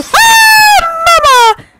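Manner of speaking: shrieking very high and loud; terrified scream. Real, not acted. Jump scare, screaming for mama in panic.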